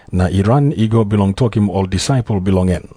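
usual speaking voice

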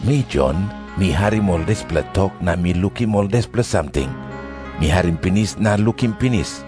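ordinary delivery

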